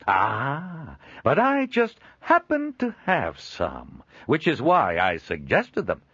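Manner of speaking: high, loud jovial merchant; booming cheerful peddler; hearty trader voice. Acted. Joel speaks, beaming.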